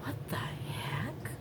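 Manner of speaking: slow, shocked whisper. Real, not acted. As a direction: breathe it out in disbelief. Watching neighbors carry things out.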